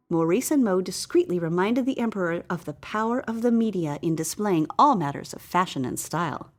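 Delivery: ordinary delivery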